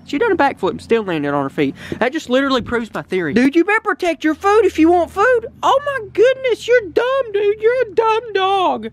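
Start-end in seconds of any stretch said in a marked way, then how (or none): 3.3-8.9 high, mocking scold of the dog; teasing scold. Real, not acted. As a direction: tease the dog, exasperated. Mocking dog losing food.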